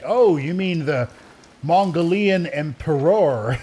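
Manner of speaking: slow, drawn-out "Mongolian emperor"; mock-smart pronunciation. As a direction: stretch "Mongolian emperor" into mock-learned syllables. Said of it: "pronounce things differently to sound smarter".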